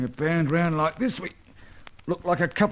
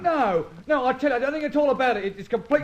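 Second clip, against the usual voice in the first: panicked pleading; 8 semitones higher than usual. Acted. Captive begs as he's dragged.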